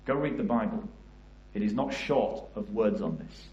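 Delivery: solemn preaching. Acted. Sermon close, God watching.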